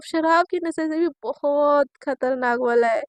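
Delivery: ordinary delivery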